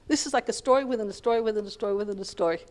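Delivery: ordinary talk, nothing marked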